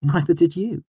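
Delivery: high-pitched quick retort; countering. A narrator's reading. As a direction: throw it back at him, pitched high. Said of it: "she countered".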